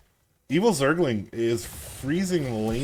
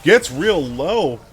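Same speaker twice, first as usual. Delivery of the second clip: excited play-by-play; 5 semitones higher, 6 dB louder than usual. Real, not acted. Hype call of a close fight.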